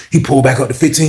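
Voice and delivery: ordinary delivery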